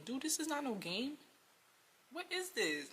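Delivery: high-pitched, incredulous complaint; acting out exasperated disbelief; exasperated retold protest. Exasperated at date treating it as game.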